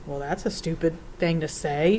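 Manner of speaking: ordinary delivery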